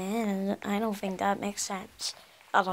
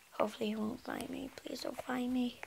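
fast, very soft pleading whisper; whispering while hiding; 12 dB quieter, faster than usual. Real, not acted. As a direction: beg under your breath not to be found. Hiding, begging not to be found.

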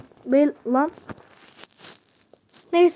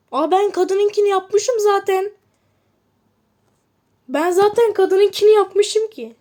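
higher, drawn-out "oh, I already made it"; surprised realization; 6 semitones higher, 4 dB louder than usual. Real, not acted. Gamer realizing he already made the woman's order, higher and surprised. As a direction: catch your own mistake out loud.

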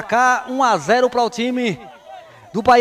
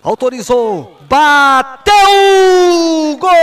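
excited goal call; 4 semitones higher, 6 dB louder than usual. Real, not acted. Goal scored in live commentary.

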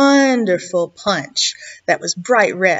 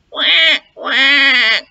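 louder, higher duck quacking; loud duck quacks; 3 semitones higher, 6 dB louder than usual. A narrator's reading. Duck at the front door, quacking loudly. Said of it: "it sounded like this".

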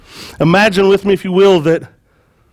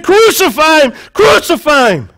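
high, loud chant of a mob; mob shouting; 9 semitones higher, 4 dB louder than usual. Acted. Crowd demands death from Pilate, shouting high and loud. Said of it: "And they said".

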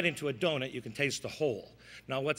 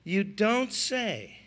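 slow deadpan; 4 semitones higher, much slower than usual. Pretend. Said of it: "It's all in the timing".

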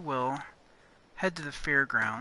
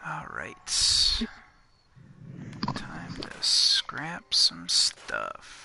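whispering; 7 semitones lower, slower than usual. Real, not acted. Whispering to herself while crafting.

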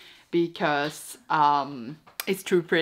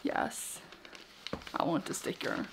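soft whispered reply; 4 semitones lower, 15 dB quieter than usual. Real, not acted. Whispering back to partner about the sticker. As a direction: whisper the answer.

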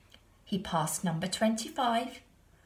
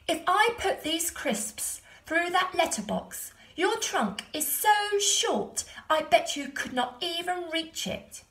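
put-on cheeky boy taunt; 9 semitones higher, 4 dB louder than usual. A narrator's reading. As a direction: squeak out the insult. Stephen taunting the elephant.

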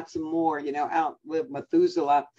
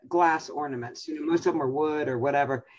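normal talking voice

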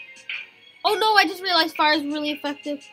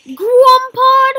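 surprised; 7 semitones higher, 12 dB louder than usual. Real, not acted. Sudden excited new creature find.

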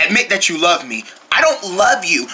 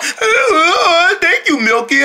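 mocking imitation; 8 semitones higher, slower than usual. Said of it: "He just sits there all".

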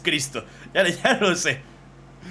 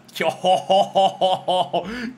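high delighted cackle breaking out; sudden helpless laugh, high and loud; loud burst of laughter; 5 semitones higher, 6 dB louder than usual. Real, not acted. Bursts out at drawing.

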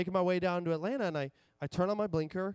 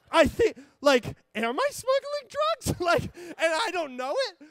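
retold panic; 9 semitones higher, 4 dB louder than usual. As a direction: replay the moment you realise you might be in trouble, pitched up. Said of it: "And I start to panic".

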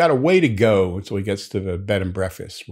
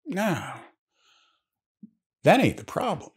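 put-on high-pitched old-timer character voice; old New England man; 9 semitones higher, 3 dB quieter than usual. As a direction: play an old local man, pitched well up. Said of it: "But the old man says".

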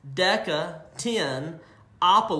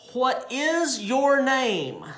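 high, slow question; Jesus asking; 7 semitones higher, much slower than usual. Acted. Jesus asks the demon its name, high and slow. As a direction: ask it slowly, reaching high. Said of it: "And then Jesus asks the demon".

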